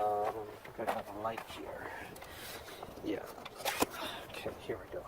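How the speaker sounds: soft whispered aside. Real, not acted. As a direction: whisper it to yourself. Whispered while setting up the light.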